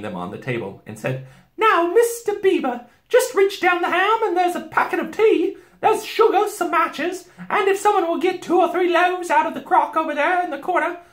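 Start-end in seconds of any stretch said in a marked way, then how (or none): 1.6-11.0 very high, loud, bustling housewife; Missus Beaver. A narrator's reading. Beaver wife directing the packing, high and bustling. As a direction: give brisk orders from the top of the voice.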